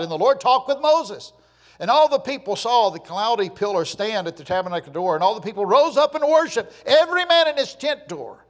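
loud, high declamation building to a shout; full-force scripture reading. Acted. Preacher reads of Israel rising to worship, full-force scripture reading. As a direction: proclaim it at full pulpit power.

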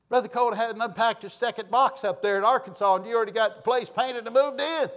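loud, high-pitched incredulous protest; mock indignation. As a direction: protest loudly in comic disbelief. Mock indignation at quick move-in.

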